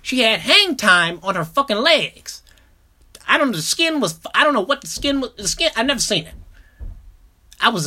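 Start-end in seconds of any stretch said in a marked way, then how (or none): none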